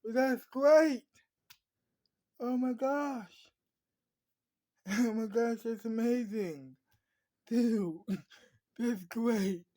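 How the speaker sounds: loud, high-pitched happy exclamations with laughs; delighted, happy relief. Acted. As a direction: burst out overjoyed, drawing out the words. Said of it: "that anger turned to happiness".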